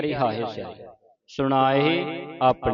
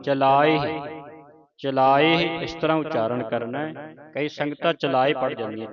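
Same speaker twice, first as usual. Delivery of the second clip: ordinary talk, nothing marked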